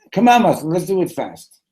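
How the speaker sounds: high, loud hurry-up; impatient urging. Acted. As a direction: chivvy a reluctant friend along. Urging sleepy friend to hurry.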